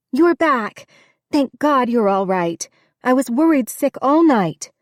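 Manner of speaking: high, loud and anxious, with rushed relief; worried French housekeeper greeting the returning older woman, loud and high. A narrator's reading. Rose bustling out, worried sick.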